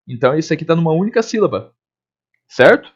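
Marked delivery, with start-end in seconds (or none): none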